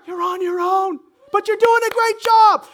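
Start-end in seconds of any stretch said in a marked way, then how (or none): none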